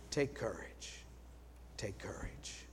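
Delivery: very soft, low, fading whisper; whispered take courage. Shown as a demonstration. Pastor blessing the congregation at communion, hushed whisper. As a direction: breathe a closing blessing. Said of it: "whispering courage".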